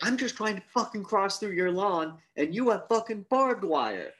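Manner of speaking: high, indignant complaining voice; mock indignant. Character griping at the homeowner, high and indignant. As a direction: whine about the lawn. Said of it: "acting the same character".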